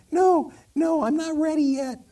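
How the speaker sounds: high, anxious tiny candle; little voice. Acted. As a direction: balk in a tiny high-pitched voice. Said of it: "this little voice say".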